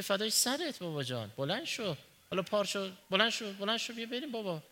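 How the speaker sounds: softly voiced father reassuring his son; comforting father. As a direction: soothe the crying boy gently.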